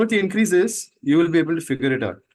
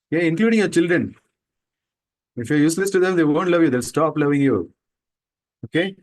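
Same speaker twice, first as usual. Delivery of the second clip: ordinary delivery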